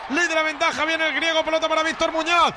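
rising, rapid goal call into long gol chant; breakneck goal commentary. Real, not acted. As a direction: build fast to the goal, then chant gol. Live goal call, play-by-play.

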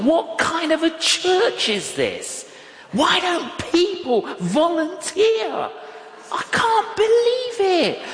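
high-pitched, drawn-out incredulous outrage; mock indignation. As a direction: wail it in exaggerated disbelief. Acting out his own indignant thought.

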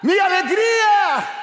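high, loud, drawn-out cry of shock; startled exclamation. Retold or imitated. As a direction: cry out as if startled by what you see. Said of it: "la gente se espanta y dice". (exclamación asustada, aguda, fuerte y lenta)

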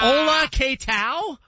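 high, slow Spanish with a Japanese lilt; Japanese-sounding Spanish. Pretend. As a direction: sound like Spanish spoken from Osaka. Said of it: "Why you saying it like you're from Osaka?".

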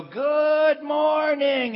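high, loud, very slow singsong good morning; chirpy morning greeting. Retold or imitated. Wally jumping out of bed, high, slow, cheery singsong. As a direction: stretch it out like a overjoyed early riser. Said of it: "he'd go".

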